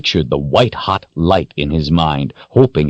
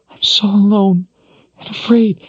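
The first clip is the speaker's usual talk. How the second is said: sobbing. Acted. Kaelin sobbing, lost in visions.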